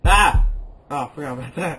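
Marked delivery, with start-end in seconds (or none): 0.0-0.5 sudden high yelp of fright; startled scream. Real, not acted. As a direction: scream at the jump scare. Jump scare in horror game.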